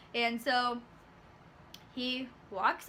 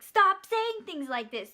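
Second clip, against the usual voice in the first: higher, louder, drawn-out mock telling-off; acted exasperated scolding; 6 semitones higher, 5 dB louder, slower than usual. Worker tells Nancy to stop saying things like this, exasperated. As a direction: scold her with exaggerated exasperation.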